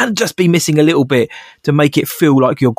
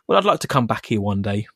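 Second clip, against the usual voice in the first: softer, lower, coy offer; soft sly reply; 4 semitones lower, 6 dB quieter than usual. Retold or imitated. Thug softly naming his price to Mon, quiet and sly. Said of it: "the guy's like".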